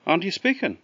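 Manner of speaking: high, light little bird voice; tiny voice calling up from the trough; wee robin voice. Acted. Robin greeting Jack at the trough, high wee voice. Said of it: "and the robin said".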